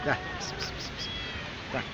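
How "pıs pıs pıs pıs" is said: soft, voiceless "psst" repeated for a cat; cat-calling hiss. Real, not acted. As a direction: call the cat with quick whispered pss sounds. (kediyi fısıltıyla pıspıslayarak)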